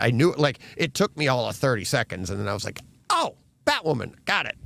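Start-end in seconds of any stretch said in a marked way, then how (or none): 3.1-4.6 high-pitched "oh" of recognition, retold; retold sudden realization. Host retelling placing the actress at last, sudden realization. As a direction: act out the name suddenly coming back.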